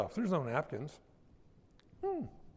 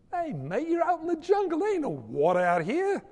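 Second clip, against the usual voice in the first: local host voice; 7 semitones higher than usual. Retold or imitated. Host voiced in jungle story.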